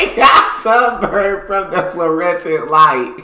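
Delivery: high, loud, slow laughing quote; laughing repeat. Real, not acted. Reactor laughing as he repeats the sunburn joke, loud and high. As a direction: gasp it out through laughter. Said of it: "Bro, I love this channel".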